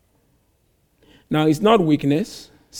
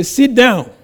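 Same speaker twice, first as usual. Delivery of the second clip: loud, higher snap of an order; mother's command; 7 semitones higher, 7 dB louder than usual. Retold or imitated. Mother ordering her child to sit, loud and sharp. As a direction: bark it like a fed-up mum at the wheel.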